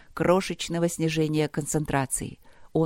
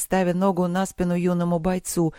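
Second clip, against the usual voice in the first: plain reading voice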